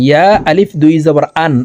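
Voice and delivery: quick spelling, "আন" with no Y glide; Ya as Hamza. Shown as a demonstration. Teacher shows viewers' common mistake, Ya pronounced as Hamza. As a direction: say "আন" starting on a bare vowel. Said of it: "ইয়ার উচ্চারণ হামজার উচ্চারণ করে".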